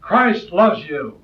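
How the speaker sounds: high, eager call of a revival preacher; urging preacher. Retold or imitated. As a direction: cheer the crowd on like a preacher at the broad gate. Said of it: "preachers up there urging them on".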